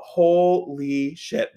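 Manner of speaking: very slow, stretched syllables; drawn-out amazement. Retold or imitated. Storyteller marvelling at the grill lifting off, drawn out. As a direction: draw out each syllable in awe.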